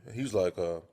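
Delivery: normal talking voice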